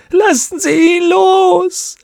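long, high, crying wail; drawn-out loud shriek of pleading; agonised scream. Acted. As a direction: scream it out slowly, in torment. Plea grows into a scream.

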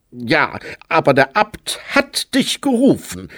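put-on voice of a reproachful novice. A narrator's reading. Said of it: "sagte der Akolyt vorwurfsvoll".

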